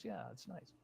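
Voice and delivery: very soft whisper; whispered aside. Shown as a demonstration. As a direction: breathe it almost silently. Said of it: "Isn't that nice?".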